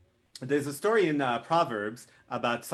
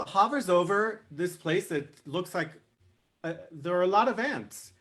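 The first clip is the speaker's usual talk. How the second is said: ordinary delivery, nothing marked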